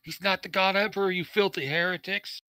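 high, indignant zealot cry; zealous outrage. Acted. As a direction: denounce them like a fanatic. Zealous sister denounces heretics.

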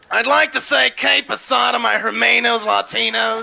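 politician's throaty attempt at Spanish; Rockefeller. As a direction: woo Latinos with someone caught in your throat. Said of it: "try and be Puerto Rican for a day".

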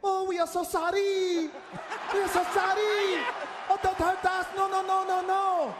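high, drawn-out put-on French voice; mock French general. French general surrendering to the enemy, mock pleading. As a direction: beg and apologise as a high-pitched caricature general. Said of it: "To the enemy would have said this".

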